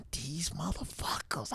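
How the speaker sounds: slow whispered curse. Retold or imitated. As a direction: hiss it under your breath. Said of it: "I'm sitting there thinking".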